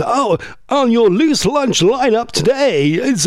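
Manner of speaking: imitated TV announcer. Host imitating a lunchtime show's introduction, announcer voice. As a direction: announce the line-up in a drawn-out put-on voice. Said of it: "and it goes".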